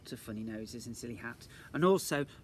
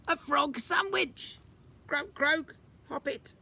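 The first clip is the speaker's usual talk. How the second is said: high, slow comic voice; croaky frog joke voice; 13 semitones higher, 6 dB louder, slower than usual. Acted. Mrs Jolly answers Jennifer's riddle in a high comic frog voice. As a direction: play the frog for laughs.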